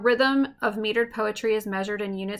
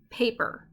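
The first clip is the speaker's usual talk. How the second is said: "paper" as PA-per, first syllable leading; stress on first syllable; 3 semitones higher than usual. Shown as a demonstration. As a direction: lean on the "pa" of "paper". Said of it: "that stresses the first syllable".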